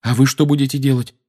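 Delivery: prompt, eager query; quick question. A narrator's reading. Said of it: "быстро спросил Гарри".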